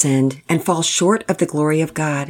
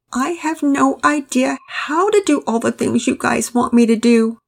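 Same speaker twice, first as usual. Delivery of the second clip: put-on high-pitched, tearful little-boy voice; tearful child; 8 semitones higher than usual. A narrator's reading. Pablo admits to Daddy he cannot cope, tearful. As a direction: plead helplessly through tears, pitch raised. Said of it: "Through tears and sniffling, he responded".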